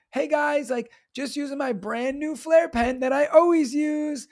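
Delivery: high, perky mock influencer; teacher influencer. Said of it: "teacher influencer life videos".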